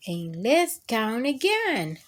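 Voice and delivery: plain reading voice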